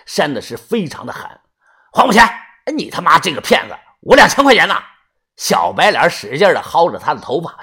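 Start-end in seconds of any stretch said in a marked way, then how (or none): 1.9-4.8 loud, high shouting demand for money; cheated young man yelling; furious yell. Acted. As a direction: scream at the swindler. Cheated boyfriend slapping, yelling.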